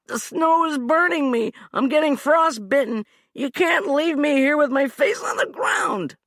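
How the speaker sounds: high, loud, shouting complaint; pleading whine. Acted. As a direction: wail about the cold snow. Hugh whining from the snow.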